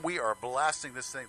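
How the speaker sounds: ordinary delivery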